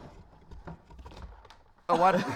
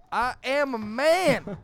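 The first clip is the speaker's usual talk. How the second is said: slow, higher, drawn-out mock-solemn declaration; prospector declaring himself; 6 semitones higher than usual. Prospector pleads his manly needs to tavern owner, drawn-out and theatrical. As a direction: stretch each phrase as a hammed-up old prospector. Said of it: "So the man says".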